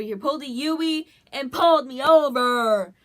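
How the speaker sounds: exasperated storyteller's outburst; loud exasperation. Real, not acted. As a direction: belt out the story's climax in disbelief.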